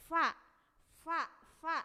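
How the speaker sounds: "fa" with air blowing through; fa demo. Shown as a demonstration. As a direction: blow out every fa. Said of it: "fa jelas ya".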